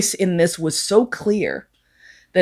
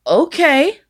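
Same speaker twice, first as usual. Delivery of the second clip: high, rising, doubtful okay; dubious okay; 9 semitones higher than usual. Retold or imitated. Teller answering a date who has little time, high and dubious. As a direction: go along with it, pitched up uncertainly. Reluctant reply to short time window.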